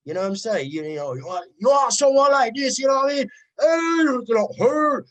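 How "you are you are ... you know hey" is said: higher and louder, toasting like a Ragga MC; hammed-up Jamaican-style Ragga hype; mock Ragga MC voice. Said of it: "It's all of that instead of".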